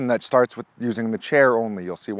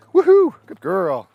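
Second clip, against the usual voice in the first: higher, louder, drawn-out woohoo and praise; slow excited whoop; 8 semitones higher, 9 dB louder, slower than usual. Real, not acted. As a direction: celebrate the catch. Celebrating dog's catch.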